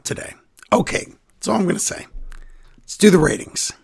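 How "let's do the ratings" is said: normal talking voice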